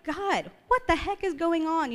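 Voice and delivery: high, drawn-out plea to God; exasperated plea. Retold or imitated.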